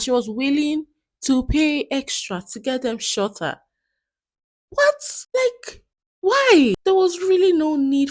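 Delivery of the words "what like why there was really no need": much higher, louder outraged what; can't believe she grabbed her hair; shocked disbelief. Real, not acted. Host reacts to the story.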